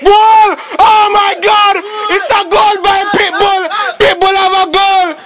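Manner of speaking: high-pitched, elated sports commentator; ecstatic goal call. Real, not acted. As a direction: go wild over the goal. Commentator celebrates Pitbull's goal.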